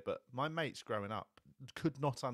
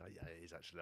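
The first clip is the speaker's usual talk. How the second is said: much softer, low sheepish admission; 6 semitones lower, 14 dB quieter, faster than usual. Retold or imitated. Husband caught out by his wife, sheepish quiet admission. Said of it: "It's like".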